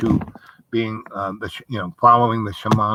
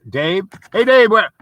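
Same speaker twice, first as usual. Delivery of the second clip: high, loud mock hail across a room; calling out; 7 semitones higher, 5 dB louder than usual. People hailing the teachers, high loud mock call. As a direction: call Dave loudly from afar.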